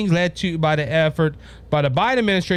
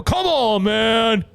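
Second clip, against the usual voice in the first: higher mock catchphrase; bad Biden impression; 4 semitones higher, much slower than usual. Host aping the president's catchphrase, high and mocking. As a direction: toss off the president's catchphrase in a put-on voice. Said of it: "I know that's a bad Biden impression".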